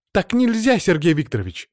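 high, shocked exclamation; scandalized schoolgirl objection; gasped protest. A narrator's reading. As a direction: start high and loud in dismay. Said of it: "ахнула Анжела".

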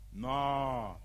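low, drawn-out no; low dismissal. Acted. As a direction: turn down the offer, low. Rejects "all Europe" as too little.

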